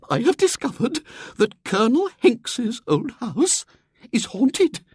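bursting to share news; trembling with eagerness. Acted. Miss Hatherley announcing to the society, eager old lady.

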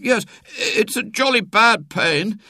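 put-on high, stammered boy's insistence; nervous schoolboy. A narrator's reading. Boy insisting on his pain to the doctor, nervous. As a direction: pitch it up and stumble into it.

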